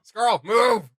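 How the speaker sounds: loud sudden yell at the screen; sudden shout. Real, not acted. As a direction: bark the order out loud.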